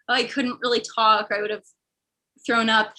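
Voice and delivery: ordinary talk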